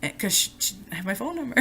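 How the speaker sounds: usual speaking voice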